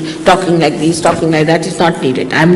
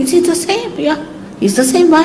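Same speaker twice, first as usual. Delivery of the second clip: puzzled; 10 semitones higher than usual. Retold or imitated. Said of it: "I told C P".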